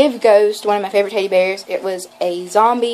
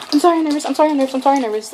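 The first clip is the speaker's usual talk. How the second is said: higher, hurried repeated apology; flustered nervous apology; 6 semitones higher, much faster than usual. Retold or imitated. Shaking fan fumbling in her bag, nervously apologizing. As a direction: loop the apology in a raised, panicky babble. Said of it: "this is all you heard".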